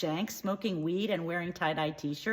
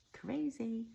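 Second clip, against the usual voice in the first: hushed aside; 3 semitones higher, 8 dB quieter than usual. Real, not acted. Shocked aside.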